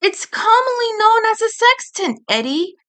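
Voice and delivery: high, fussy know-it-all voice; prim scolding boy. Acted. Edd chiding friends for roughhousing, prim and high. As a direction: scold them like a prissy schoolboy.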